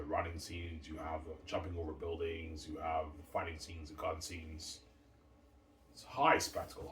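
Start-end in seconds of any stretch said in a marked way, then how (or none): 5.9-6.9 excited. Real, not acted. Hyping his movie.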